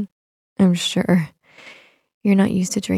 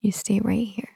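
soft whispered command; hushed command before leaving; whispered order; 6 dB quieter than usual. Acted. Telling the listener to stay.

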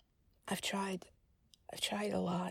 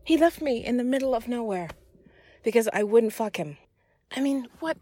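outraged; 3 semitones higher, 7 dB louder than usual. Acted. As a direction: vent outrage at being left stranded. Outraged at being abandoned.

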